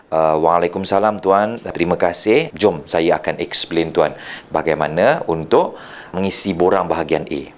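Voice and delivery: low, measured, helpful reply; mirrored low tone. Shown as a demonstration. Officer offers to explain the form, mirroring the low tone. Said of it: "match and mirrorkan intonasi suara".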